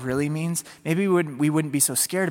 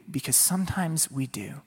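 whispered aside; 6 dB quieter than usual. Real, not acted. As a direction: draw listeners in softly. Preacher drops to whisper.

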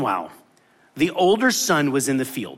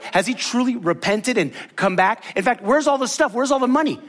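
higher, demanding questions; indignant demand; 7 semitones higher than usual. Retold or imitated. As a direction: press him for the missing money. Voicing the older brother's outrage.

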